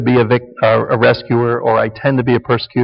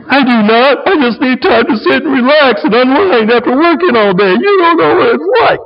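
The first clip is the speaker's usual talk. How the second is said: mock whining, much higher, rising to a fake cry; wailing husband; 10 semitones higher, 3 dB louder than usual. Husband defending himself to wife, mock wailing. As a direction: wail it like a hammed-up martyr. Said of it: "they're wrangling".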